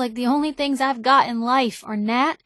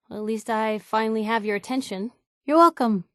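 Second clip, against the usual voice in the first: ordinary delivery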